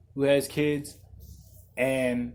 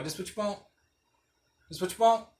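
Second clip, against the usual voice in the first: 1 mock quote; 6 semitones higher, 5 dB quieter, faster than usual.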